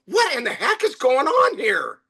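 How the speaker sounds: high-pitched, panicked outburst; mock panic. Usually calm server running down to owner, panicked. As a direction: imitate a flustered server in a panic. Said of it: "running down in a panic".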